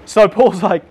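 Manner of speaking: normal talking voice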